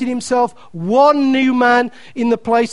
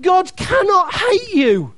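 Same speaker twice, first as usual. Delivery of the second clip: preacher's shout; 6 semitones higher than usual. Shown as a demonstration. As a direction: hit hate with full force. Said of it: "Hear that prophetically".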